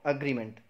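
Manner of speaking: "अग्रीमेंट" as uh-GREE-ment; short "uh" opens "अग्रीमेंट"; correct pronunciation. Shown as a demonstration. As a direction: model "अग्रीमेंट" with schwa start. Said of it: "क्या बोलेंगे?".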